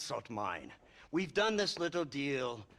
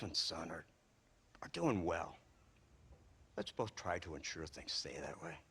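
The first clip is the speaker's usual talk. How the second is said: soft veiled threat; 10 semitones lower, 8 dB quieter than usual. Acted. Veiled threat about family.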